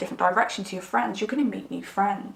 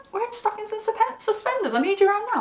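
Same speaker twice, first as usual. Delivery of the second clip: much higher, disbelieving echo; incredulous repeat; 12 semitones higher than usual. Retold or imitated. Stunned narrator repeating the friend's message aloud. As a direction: parrot it back in comic shock.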